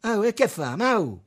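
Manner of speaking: high, loud cry of objection; shouted protest. A narrator's reading. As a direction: shout to get his attention. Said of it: "gridò Zugabbo". (protesta gridata)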